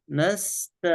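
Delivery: نست with held thin s and light ta; clear sin. Shown as a demonstration. Sheikh demonstrates the sin again, held clearly. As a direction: linger on the s of "نست". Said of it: "مخرج السين".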